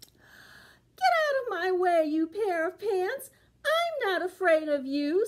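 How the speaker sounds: put-on high, crying-edged old lady voice; an old woman shooing things off, pitched high; spunky granny telling the pants off; defiant little old lady.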